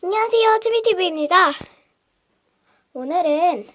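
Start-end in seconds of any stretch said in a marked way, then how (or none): 0.0-1.5 bright intro. Real, not acted. YouTube channel greeting.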